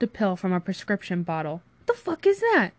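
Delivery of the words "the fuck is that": very high-pitched, indignant question; alarmed demand. Acted. Friend spots a pill popped, alarmed. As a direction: snap it out in alarm.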